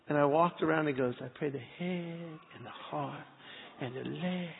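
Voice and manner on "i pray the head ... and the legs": put-on soft hushed child's prayer, whispered joys; little boy praying. Son copying dad's prayer over the cat, soft whispered child voice. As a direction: murmur it like a small boy blessing the cat.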